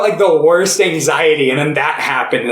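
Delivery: ordinary talk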